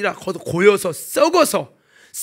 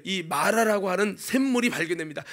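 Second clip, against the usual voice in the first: normal talking voice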